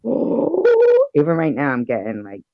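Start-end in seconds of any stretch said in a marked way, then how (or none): 0.0-1.1 sudden high yelp of fright; a squeaky shriek at the spider; startled oh. Retold or imitated. Telling a friend about the spider, high frightened oh. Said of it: "I said".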